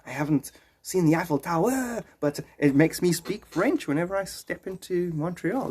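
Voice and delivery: hammed-up French accent with swinging tune. Border visitor explains his sudden change on stepping into Quebec, French accent. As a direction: slip helplessly into a comic French accent. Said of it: "took on a French accent".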